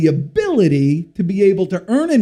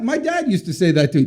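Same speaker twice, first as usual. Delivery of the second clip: ordinary talk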